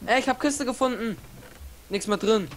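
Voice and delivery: ordinary talk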